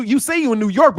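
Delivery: ordinary delivery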